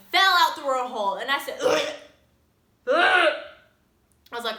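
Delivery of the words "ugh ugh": loud, high grossed-out "ugh"; disgusted ugh. Retold or imitated. Vlogger reacting to a gross piercing story, disgusted groan. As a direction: recoil with a loud noise of disgust. Reacting to ring falling through hole.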